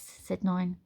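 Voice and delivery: plain reading voice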